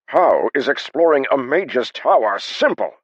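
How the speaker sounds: high, loud, slow outraged yell; screaming. A narrator's reading. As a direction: drag out the outrage, yelling. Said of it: "Haber practically screamed".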